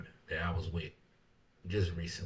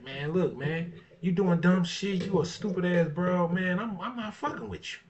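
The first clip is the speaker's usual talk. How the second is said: pretend telling off; 7 semitones higher than usual. Acting out telling someone off.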